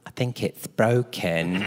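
slow, drawn-out nurse diagnosis; mock-hesitant nurse breaking bad news; imitated set nurse. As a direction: stretch it out in a put-on nurse voice. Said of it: "and she goes".

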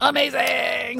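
high, gleeful shout; excited shout. Real, not acted. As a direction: cheer the word out. Echoing science is amazing.

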